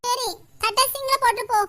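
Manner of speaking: usual speaking voice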